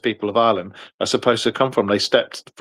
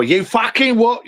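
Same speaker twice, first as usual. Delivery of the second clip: slow, high mock Cockney rage; ballistic cockney; 11 semitones higher, slower than usual. Retelling how he sounds in a rage, slow mock Cockney. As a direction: snarl it like a Cockney losing his rag. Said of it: "I've been told I go, uh, what, ballistic cockney".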